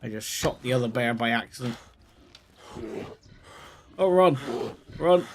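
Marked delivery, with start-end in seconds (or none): 4.0-5.3 loud, raised cry to flee; panicked urging. Pretend. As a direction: shout it as danger closes in. Fleeing two bears in game.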